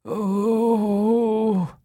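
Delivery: high, crying moan of pain. A narrator's reading. Maroney seized by a fit, high crying moan. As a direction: cry out in a spasm. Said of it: "He moaned".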